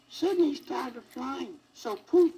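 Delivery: put-on high squeaky old fairy voice. A narrator's reading. Fairy godmother squeaking down at the sad elephant. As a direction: squeak it out like a tiny old lady. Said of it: "squeakily said".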